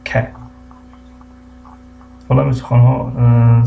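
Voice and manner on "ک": ک as a short hard k; C pronounced "k". Shown as a demonstration. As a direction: give ک alone as one crisp k sound. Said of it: "حرف سی ک تلفظ شده است". (تلفظ کوتاه صدای «ک»)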